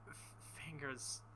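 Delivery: usual speaking voice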